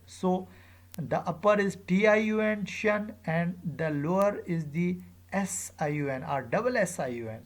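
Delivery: ordinary talk, nothing marked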